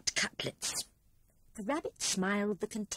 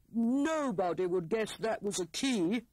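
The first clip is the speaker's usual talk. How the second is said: pleased Hare voice. A narrator's reading. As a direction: sound pleased with yourself. Hare boasts.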